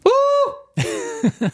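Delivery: put-on high whooping Irish accent yell; a one-word Irish accent "Woo". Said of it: "Have you ever heard my Irish accent?".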